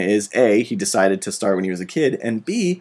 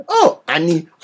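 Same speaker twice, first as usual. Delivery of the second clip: mock little Greedo; 6 semitones higher than usual. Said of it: "little Greedo".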